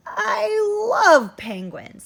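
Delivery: loud, stretched-out joy over penguins; delighted grandpa. Acted. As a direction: Grandpa gushes loudly about penguins. Grandpa voiced in story.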